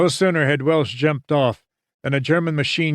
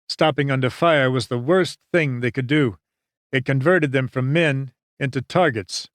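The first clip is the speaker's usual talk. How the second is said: ordinary delivery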